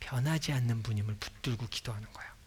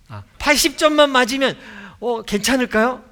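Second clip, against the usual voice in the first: put-on a kid's much higher, much louder eager plea; child asking dad; 10 semitones higher, 11 dB louder than usual. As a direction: beg your dad like a hopeful child.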